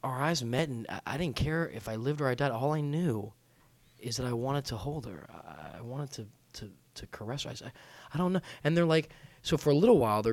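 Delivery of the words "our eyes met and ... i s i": hushed, faltering romantic confession; feigned lovesick rapture. Acted. Fooling girls with over-the-top love talk, mock rapture. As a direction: let it trail softly, as if overcome. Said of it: "so over the top".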